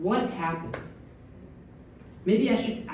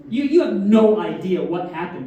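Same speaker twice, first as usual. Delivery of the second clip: ordinary talk, nothing marked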